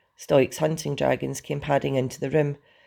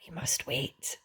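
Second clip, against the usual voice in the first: very soft, threatening dragon; menacing purr; 9 dB quieter, slower than usual. A narrator's reading. Hook Fang threatens the newcomer, soft menacing purr. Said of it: "purred Hook Fang menacingly".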